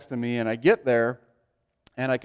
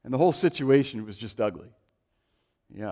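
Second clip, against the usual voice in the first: ordinary talk, nothing marked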